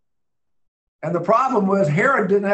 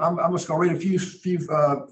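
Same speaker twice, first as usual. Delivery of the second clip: usual speaking voice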